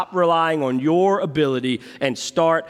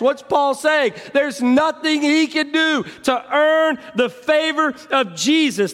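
normal talking voice

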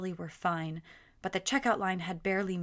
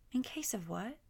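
small, soft question rising up high; child's question; 5 semitones higher, 5 dB quieter than usual. A narrator's reading. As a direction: ask it like a curious little girl. Daughter questions mother.

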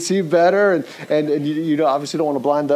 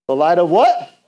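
louder, coaxing question to the crowd; loud prompt; 3 semitones higher, 7 dB louder than usual. Real, not acted. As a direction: call it out so they answer. Prompting congregation for answer.

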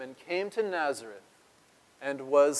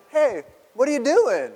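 mock emotionless; 9 semitones higher, 6 dB louder than usual. Shown as a demonstration. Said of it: "I'm sure completely emotionless".

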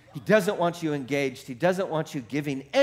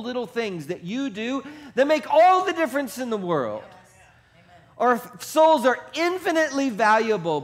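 higher and louder, fired-up preacher; full-force preaching; 9 semitones higher, 5 dB louder than usual. Acted. Pastor rousing his congregation, full-force fired-up preaching. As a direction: preach it hard and rising to the back row.

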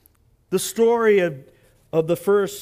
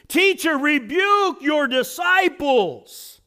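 higher, slow, loud rebuke; indignant Pharisee demand; 7 semitones higher, 5 dB louder, slower than usual. Acted. Pharisees telling Jesus to silence the crowd, higher loud indignant.

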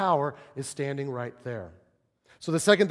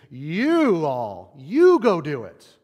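slow, louder, stressing "you" in "you all, you go do it"; emphatic you; 7 dB louder, much slower than usual. Shown as a demonstration. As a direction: push hard on "you", slowly and loud. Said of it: "That word "you" in the Greek is in the emphatic tense".

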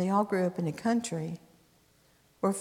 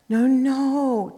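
cried out; 6 semitones higher, 5 dB louder than usual. Acted. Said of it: "Tamar cried".

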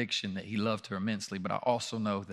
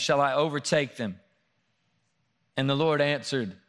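louder, higher dramatic scripture reading; preaching at full force; 4 semitones higher, 4 dB louder than usual. Acted. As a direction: proclaim it from the pulpit.

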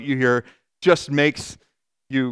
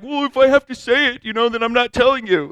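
higher, sulky imitation of a stubborn partner; petulant mock reply; 7 semitones higher than usual. Imitating a spouse who refuses to say what's wrong, higher and sulky. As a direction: play the stubborn one who won't explain. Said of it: "and they're like".

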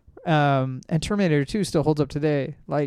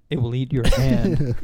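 mock ominous warning; 3 semitones lower, 4 dB louder, slower than usual.

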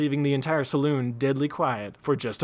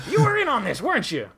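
accusing shout; 9 semitones higher than usual. A narrator's reading. Daggett accusing.

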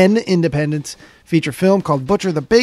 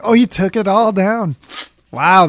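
normal talking voice

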